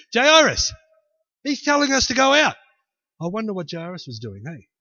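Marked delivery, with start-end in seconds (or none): 0.1-2.6 high, raised protest; indignant complaint. Acted. Mourners to Jairus, high indignant protest. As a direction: call on the host to step in.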